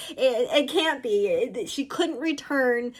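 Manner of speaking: ordinary delivery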